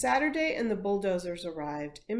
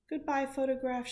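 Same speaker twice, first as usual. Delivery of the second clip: sniffling; 6 semitones higher than usual. A narrator's reading. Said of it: "she sniffled".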